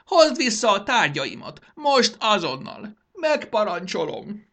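high, loud, slow demand; imperious tantrum; screeched command. Acted. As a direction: shriek orders like a spoiled ruler. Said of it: "rikácsolt Mondán".

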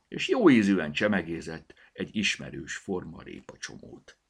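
ordinary delivery, nothing marked

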